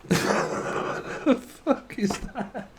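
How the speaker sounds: wheezy silent laugh through the words; gasping with laughter, barely voiced. Real, not acted.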